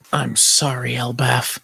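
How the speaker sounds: low, soft murmur; defeated apology. Acted. Hero apologizing to Elbaf, defeated. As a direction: sink into quiet regret.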